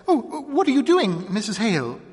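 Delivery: high, loud, startled cry; a sudden alarmed question; a mild jolt of alarm. A narrator's reading. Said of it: "asked the sheriff's wife, startled".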